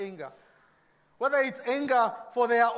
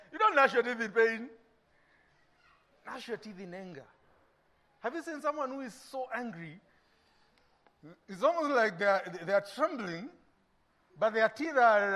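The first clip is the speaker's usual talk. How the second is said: ordinary delivery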